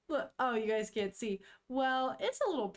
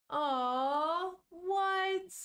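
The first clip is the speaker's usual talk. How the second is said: surprised aw; 6 semitones higher than usual. Real, not acted. Reacting to cute scene.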